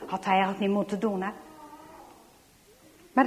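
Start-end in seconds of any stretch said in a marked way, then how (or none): none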